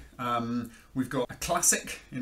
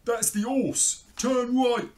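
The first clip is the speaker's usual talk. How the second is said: high, loud crook's voice; put-on voice of a storybook thief; thief Len; 12 semitones higher, 7 dB louder, slower than usual. A narrator's reading. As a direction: call out directions to your partner in crime. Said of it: "and Len said".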